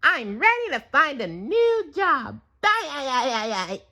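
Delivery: high eager squeaky voice; squeaky goodbye trailing off; SpongeBob voice. A narrator's reading. SpongeBob leaving eagerly, high squeaky voice.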